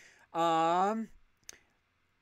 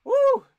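sudden high loud woo; excited whoop; 9 dB louder than usual. Real, not acted. Overwhelmed by a viewer's support, a high loud whoop. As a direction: let out a burst of joy. Overcome thanking longtime viewer.

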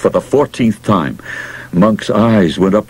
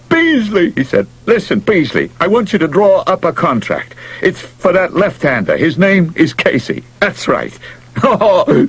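high, thrilled phone call; excited manager on phone; 8 semitones higher than usual. Acted. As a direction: rave into the phone.

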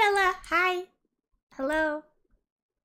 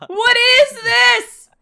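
high, slow, shrieking through laughter; laughing shriek; 9 semitones higher, 9 dB louder, much slower than usual. Real, not acted. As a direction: cry out while cracking up. Shocked by a funny avatar.

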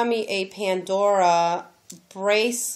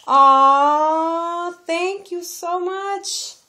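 touched aw; 8 semitones higher, 6 dB louder, much slower than usual. Real, not acted. Reading the friend's card.

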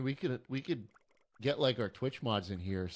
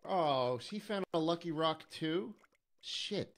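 surprised; 7 semitones higher, slower than usual. Real, not acted. Spots another lucky rock.

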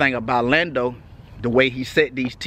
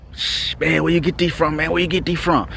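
higher, curious asking voice; imitating curious strangers; 4 semitones higher than usual. People who spot his gold teeth ask, higher and curious. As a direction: mimic people pestering him about the grills.

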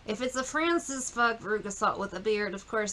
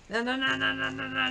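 ordinary delivery

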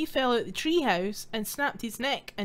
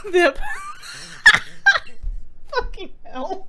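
usual speaking voice

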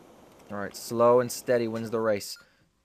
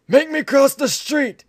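put-on young person faking old; 13 dB louder than usual. Voicing the game character.

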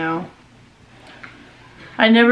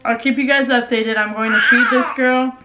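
ordinary talk

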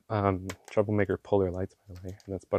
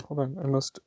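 ordinary delivery

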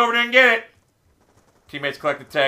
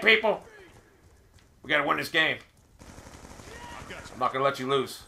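usual speaking voice

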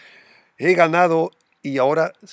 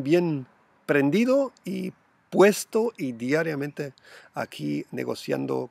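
ordinary delivery

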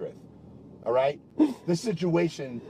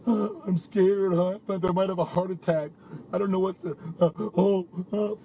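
mocking impression. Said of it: "Stop with your stupid fucking games".

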